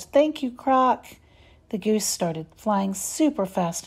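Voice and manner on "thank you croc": higher, slow call from the sky; yelled thanks. A narrator's reading. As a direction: shout thanks back down to the croc. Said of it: "Ace yelled".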